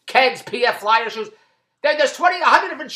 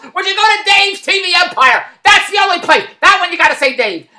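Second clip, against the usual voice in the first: loud plug; 7 semitones higher, 5 dB louder than usual. Real, not acted. Shouted plug for his site.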